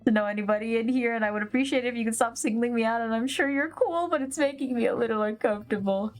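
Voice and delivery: fast, anxious rambling; nervous rush. Acted. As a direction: blurt it all out quickly.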